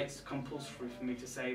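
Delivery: ordinary talk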